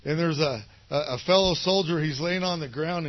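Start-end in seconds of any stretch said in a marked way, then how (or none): none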